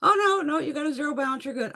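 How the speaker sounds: high-pitched mock receptionist; helpful receptionist. Voices receptionist on the phone.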